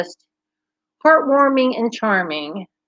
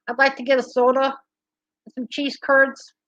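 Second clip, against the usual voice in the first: quick, higher-pitched Minnesota accent; 5 semitones higher than usual. Shown as a demonstration. Host trying out Minnesota on viewers, Minnesota accent. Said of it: "as close as I can do to Minnesota".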